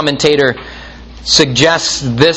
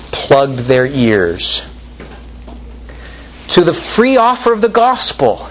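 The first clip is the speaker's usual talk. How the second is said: usual speaking voice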